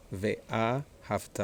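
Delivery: "ואהבת" slowly split as ve-A, havTA; stress by accent marks. Shown as a demonstration. Teacher showing students the correct word, slow accented syllables. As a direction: sound out "ואהבת" slowly, marking A and TA. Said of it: "this is an accent mark".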